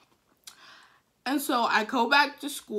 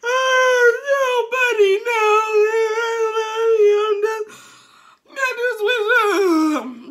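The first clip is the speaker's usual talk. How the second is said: hammed-up bawling, high and loud; crying; 12 semitones higher, 11 dB louder than usual. Girl dreading a break from boyfriend, crying. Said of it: "I'm crying".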